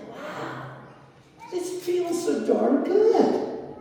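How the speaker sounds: high, very slow, marvelling; awed bliss. Retold or imitated. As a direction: savour each word as if in wonder. Recalled blissful inner thought.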